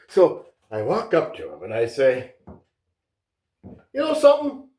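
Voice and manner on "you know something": retold challenge, pitched up at the start; blunt confrontation. Contractor retells telling off Andy Warhol, bluntly confrontational. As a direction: call him out to his face, as retold. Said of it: "I told him right to his face".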